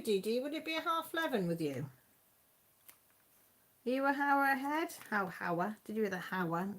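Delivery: ordinary talk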